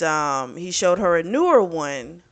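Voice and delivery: ordinary talk, nothing marked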